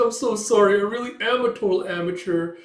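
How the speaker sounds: hammed-up embarrassed lady; flustered apology.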